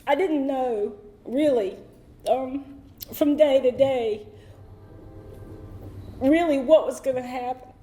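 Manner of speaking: fighting back tears as she talks about home; choked up. Real, not acted. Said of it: "y'all excuse me if I get emotional".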